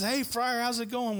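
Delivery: imitating a friend calling on the phone; friendly greeting. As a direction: greet him like an old buddy. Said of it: "he says".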